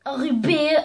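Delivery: ordinary delivery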